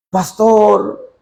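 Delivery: voz aguda de hermana que suplica; hermana suplicante. Retold or imitated. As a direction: imita a la hermana que ruega. Hermana pide irse, voz actuada. (hermana que suplica al pastor, voz aguda)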